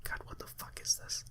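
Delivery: whispered disbelief; real appalled whisper; whispered aside. Real, not acted. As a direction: mutter to yourself in horror. Reader aside, disgusted.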